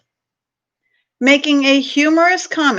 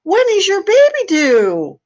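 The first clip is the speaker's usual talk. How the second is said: sudden, higher, louder blurt; 9 semitones higher, 4 dB louder than usual. Acted. A stroller blurting a question to a big-bellied man, high and sudden. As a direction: blurt it out before thinking. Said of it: "blurt out".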